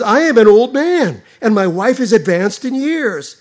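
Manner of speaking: put-on loud, protesting old man; voicing old Zechariah. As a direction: push the excuse out loudly. Zechariah's excuse voiced in sermon.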